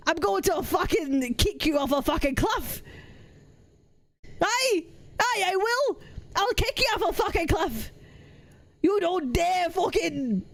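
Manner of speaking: mock-threatening Scottish accent; hammed-up Scottish hard man; angry Scottish accent. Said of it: "sound like I'm fucking angry half the time".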